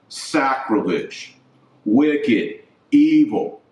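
ordinary delivery

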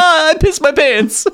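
high, slow, laughing whine; tased teen boy, hammed-up wail through laughter; mock whimper.

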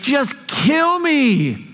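slow, high, dragged-out cry; despairing outburst. Retold or imitated. Angry Jonah begging God to end it, wailing. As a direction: wail it to the sky. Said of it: "so angry".

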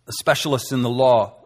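ordinary talk, nothing marked

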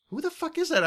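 high-pitched, baffled outburst; mock bafflement. Host recalling his forgotten joke, mock bafflement. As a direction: retell it as exasperated confusion. Retelling failure to get own joke.

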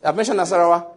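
loud, rising rally shout; roaring rallying speech. Acted. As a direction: declare it like a battle cry. Loud proclamation over Nigerian states.

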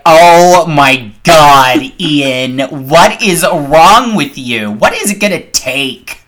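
loud, slow, fed-up groan at her ex; exasperated eye roll. Retold or imitated. Rolling her eyes at her ex's old message, slow exasperated groan. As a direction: drag it out like the biggest eye roll. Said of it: "I was like".

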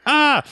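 sharp high yelp of surprise; startled scream. Retold or imitated. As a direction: jump at the sight. Marie startled by Lurch.

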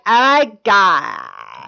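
very drawn-out airhead "oh my God"; mock girl voice. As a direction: stretch it out like a mocked bimbo. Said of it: "just how all girls talk in your head".

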